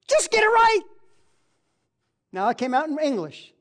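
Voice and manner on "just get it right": high, loud exasperated plea. Retold or imitated. Preacher acting out frustration, an exasperated plea. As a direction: push it out in frustration. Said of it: "I'm so filled with".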